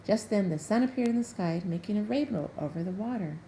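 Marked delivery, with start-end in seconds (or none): none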